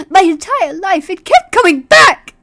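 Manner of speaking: high, loud desperate cry; frantic shout. Acted. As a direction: scream it out in terror. Grandma's frantic outburst.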